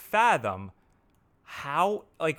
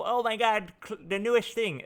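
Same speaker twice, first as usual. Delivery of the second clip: much higher, gushing over a new tool; mock gushing fanboy; 7 semitones higher than usual. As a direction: squeal like a hyped tool fanboy. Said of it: "I'm not, like, a tool".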